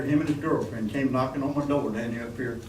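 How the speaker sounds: ordinary talk, nothing marked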